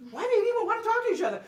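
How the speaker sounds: high, fast, loud and laughing, mock disbelief; incredulous laughing question. To the interviewer, mocking the authorities' disbelief, laughing incredulous. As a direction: toss it off as an absurd question, laughing. Mocking baffled opponents' view.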